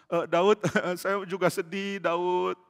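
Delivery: slow, badly faked sobbing; fake crying. Follower pretending to share David's grief, fake crying. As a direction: pretend to weep along, drawn out. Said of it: "kita pura-pura mau nangis".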